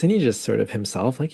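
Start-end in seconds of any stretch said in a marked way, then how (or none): none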